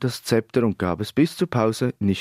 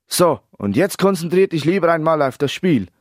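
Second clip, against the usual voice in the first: high, exasperated telling-off; irritated, emphatic order; annoyed; 6 semitones higher, 4 dB louder than usual. A narrator's reading. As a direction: say it pointedly, fed up. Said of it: "Genervt betonte er".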